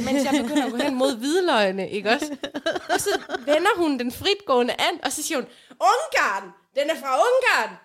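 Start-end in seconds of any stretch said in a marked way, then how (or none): 5.7-7.8 louder, higher mock cry of Ungarn; indignant old woman, raised voice; retold loud exclaim. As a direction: play the outraged old lady shouting. Old lady exclaims in store.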